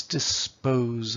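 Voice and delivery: dis-POSE drawn out within the request; slow, weighted second beat of "dispose"; stress on second syllable. Shown as a demonstration. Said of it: "That's the heavy beat".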